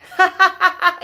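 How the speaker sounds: high, loud laugh; bright burst of laughter. Real, not acted. Laughing at her wet hair while showing her friend. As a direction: laugh out at herself.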